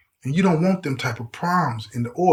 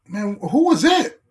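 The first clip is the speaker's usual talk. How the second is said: mock excited, drawn-out higher question; 4 semitones higher than usual. Officers asking who that woman was, imitated excitement. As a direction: stretch it out with eager, imitated excitement. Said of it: "All excited like".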